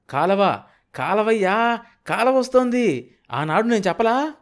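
loud, high triumphant cry; excited announcement. A narrator's reading. Kanakayya announcing the coming canal to Sambayya, jubilant. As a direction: proclaim long-awaited news, voice climbing.

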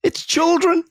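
very high, insistent mock correction; imitating Becca insisting. Becca insisting on her pronunciation, mock-exasperated. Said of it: "she's like".

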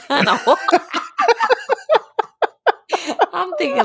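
high giggly voice; laughing. Real, not acted. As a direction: speak through laughter.

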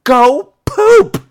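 high, loud, slow exasperated plea; mock pleading with the poop dragged out. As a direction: stretch out the order in disbelief. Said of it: "I'm like".

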